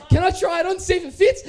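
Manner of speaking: can't wait to get it on; eager excitement. Retold or imitated. Eager question to the shopkeeper.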